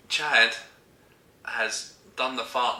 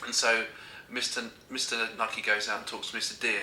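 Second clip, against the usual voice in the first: ordinary talk, nothing marked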